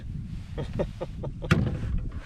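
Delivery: quiet breathy chuckle; soft amused laugh. Real, not acted. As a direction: laugh it off softly. Laughs at the dinky bass.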